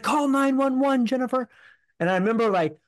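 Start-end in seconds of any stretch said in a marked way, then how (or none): 0.0-1.5 father calling to the wife during the break-in, urgent and high; urgent plea. Retold or imitated. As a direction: call out to the wife in urgent alarm. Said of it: "My dad's like".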